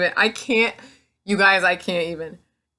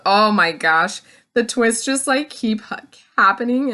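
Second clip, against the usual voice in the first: usual speaking voice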